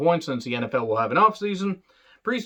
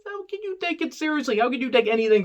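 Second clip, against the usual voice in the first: incredulous; 12 semitones higher than usual. Real, not acted. Exasperated high rant.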